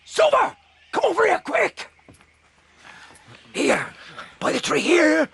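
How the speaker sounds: high urgent shouts for Silver; calling out. Acted. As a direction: yell him over in alarm. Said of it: "George Murray calls out".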